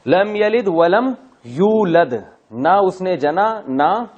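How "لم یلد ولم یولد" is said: slow Quran-style recitation. Shown as a demonstration. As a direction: stretch out each word of the verse. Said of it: "recites the verse slowly". (آہستہ تلاوت)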